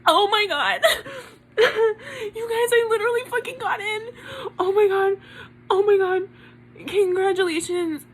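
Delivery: high-pitched, laughing-crying disbelief; real overjoyed shock; freaking out. Real, not acted. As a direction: squeal it through tearful laughter. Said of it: "I'm literally freaking out right now".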